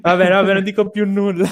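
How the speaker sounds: high, loud, laughing give-up; laughing protest. Real, not acted. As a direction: throw up your hands while laughing. Teased about Apple, protests laughing.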